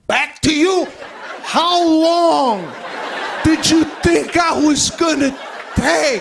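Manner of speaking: loud, high disbelieving yell; loud mock outrage at wife; incredulous shout. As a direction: blow up at the wife. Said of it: "I said".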